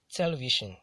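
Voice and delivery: "television" with TE hit hardest, then falling; first-syllable stress. Shown as a demonstration. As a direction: punch TE at the start of "television". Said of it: "stressed on the first syllable".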